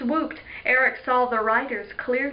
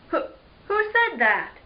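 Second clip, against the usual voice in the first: startled question; 6 semitones higher than usual. Acted. Startled by unseen voice.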